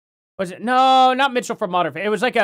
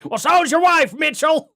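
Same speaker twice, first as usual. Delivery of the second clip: high, loud mock yell; 9 semitones higher, 6 dB louder than usual. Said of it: "That's what it is".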